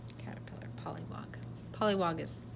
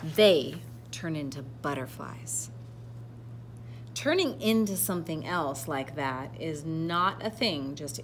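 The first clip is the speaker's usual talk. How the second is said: animated read-aloud with deliberate emphasis; picture-book narration; 8 dB louder than usual. A narrator's reading. Reader telling children of a proud caterpillar, storybook narration. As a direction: read to children, projecting key words.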